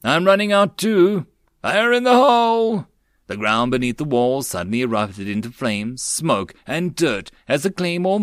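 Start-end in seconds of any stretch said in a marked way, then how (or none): 0.0-2.9 loud, high urgent warning; battle shout. A narrator's reading. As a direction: shout over the gunfire. Soldiers shout during battle.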